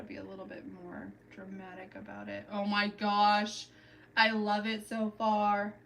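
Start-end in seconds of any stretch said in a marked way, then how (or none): none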